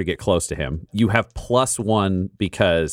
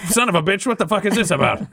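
high, outraged yelp of disbelief; outraged doctor; 11 semitones higher than usual. Acted. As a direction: squeal it out like a cheated man. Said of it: "And he's like".

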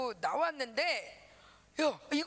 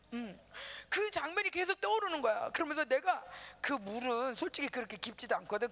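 normal talking voice

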